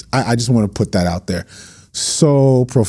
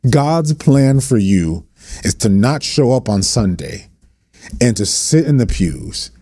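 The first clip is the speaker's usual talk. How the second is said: impassioned preaching; 3 semitones higher than usual. Real, not acted. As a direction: preach it hard to pastors. Preaching continues.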